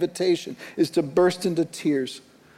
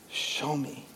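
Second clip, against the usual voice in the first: hushed plea; 2 semitones lower, 11 dB quieter than usual. Acted. Jesus's plea voiced by preacher.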